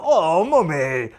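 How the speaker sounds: slow, loud, drawn-out cheeky greeting; mock greeting. Greeting the mummy face to face, slow and loud. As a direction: drag out a cheeky hello to the mummy. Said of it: "and we go".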